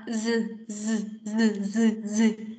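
a string of buzzing "z, z" sounds; repeated voiced z. Shown as a demonstration. Teacher modelling the z for a shy student. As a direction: repeat "z" with the throat humming. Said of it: "Con làm thế nào".

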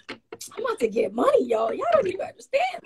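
high, teasing brag to upset friends; gleeful boast. Retold or imitated. Bragging to angry friends about the settlement, gleeful and high. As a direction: crow about getting money. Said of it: "And I'm just like".

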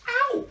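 sudden high, loud "ow"; comic yelp of pretend pain; mock pain yelp. Stretching a balloon for children, hammed-up pained yelp.

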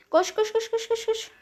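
urgent high panicked chant; fleeing, urging yourself on; being chased. Real, not acted. Fleeing in Among Us.

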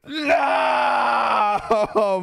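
loud anguished cry of No; anguished shout. Real, not acted. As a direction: shout it in real dismay. Loses horse in game.

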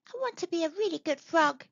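high, quick tadpole voice; little tadpole dreaming of being a frog; eager tadpole. A narrator's reading. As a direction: think it aloud, keen and small. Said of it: "he thought".

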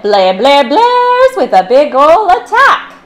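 slow, loud, high blare; blaring trumpet. Acted. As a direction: blast it like a trumpet.

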